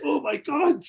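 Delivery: high, loud thrilled exclamation; starstruck gasp. Retold or imitated. Fan after chatting with [name], thrilled gasp. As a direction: gush it out in disbelief.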